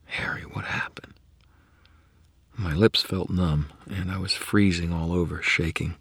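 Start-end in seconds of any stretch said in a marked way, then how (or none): 0.1-1.1 hushed whispered question; soft. Acted. Murphy whispering to dazed Harry on the floor. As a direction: ask it in a whisper, worried. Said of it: "she said softly".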